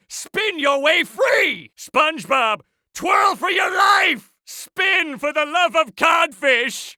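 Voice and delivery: high, drawn-out shouts; frantic urging. Acted. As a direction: cry out each order as if everything depends on it. Frantic orders to SpongeBob.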